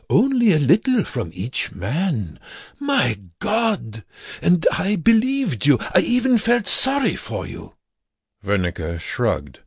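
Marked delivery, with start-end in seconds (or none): 0.1-7.7 high, rising bitter outburst; bitter, betrayed. Acted. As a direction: pour out the betrayal. Bitter continuation.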